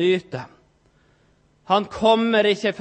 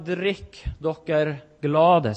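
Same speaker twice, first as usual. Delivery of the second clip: slow invitation; 4 semitones lower, much slower than usual. Acted. Preacher voicing Jesus' invitation.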